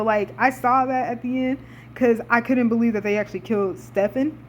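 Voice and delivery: normal talking voice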